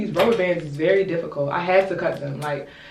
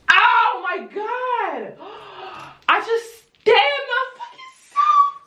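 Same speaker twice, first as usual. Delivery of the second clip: high, drawn-out cry with a gasp; real dramatic shock; much slower than usual. Real, not acted. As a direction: yelp as if you just jabbed yourself. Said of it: "I'm so dramatic".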